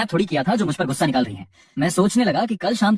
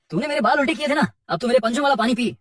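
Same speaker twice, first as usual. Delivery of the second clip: gleeful revenge; 7 semitones higher than usual. A narrator's reading. Cat's mischievous revenge.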